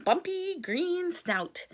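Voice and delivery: ordinary delivery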